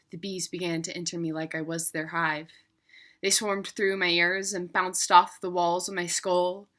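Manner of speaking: ordinary delivery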